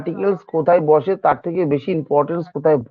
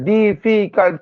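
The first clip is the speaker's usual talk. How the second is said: Difficult slowly split into three beats; syllable by syllable; 2 semitones higher, much slower than usual. Shown as a demonstration. Teacher counting syllables of difficult for students, slow and separated. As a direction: sound out ডি, ফি, cult separately. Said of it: "তিনবার হচ্ছে".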